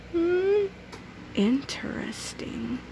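slow, breathy whisper; suspicious whisper. Acted. Lunch lady muses on the substitute, slow whisper. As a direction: whisper it, eyes narrowed in thought.

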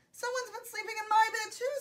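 high-pitched mother bear; Mama Bear voice. A narrator's reading. Mama Bear finds her bed used, high voice. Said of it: "said the Mama Bear".

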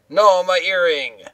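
high, loud, slow wail of loss; mock anguished cry. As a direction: wail over the lost earring. Character's ear grabbed in game.

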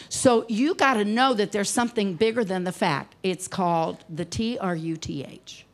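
ordinary talk, nothing marked

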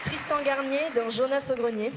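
loud announcer call; shouting. Acted. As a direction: belt the name out. Shouting nominees.

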